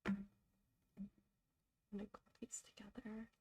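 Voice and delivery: whispering to yourself while working. Real, not acted. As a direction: murmur it under your breath.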